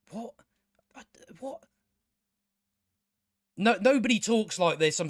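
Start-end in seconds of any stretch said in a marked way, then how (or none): 0.1-1.6 tiny, stunned questioning; baffled, hushed disbelief; dumbfounded. Real, not acted. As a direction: falter softly, lost for words. Speechless at absurd line.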